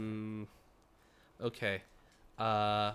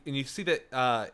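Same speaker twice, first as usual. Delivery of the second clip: ordinary talk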